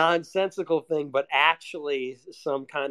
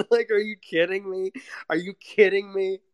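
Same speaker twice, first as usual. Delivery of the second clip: pitched-up, exasperated disbelief; half-laughing, higher-pitched indignation; mock incredulity; 4 semitones higher than usual. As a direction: protest as if you can't believe the teasing. Acting out annoyance at dad's teasing.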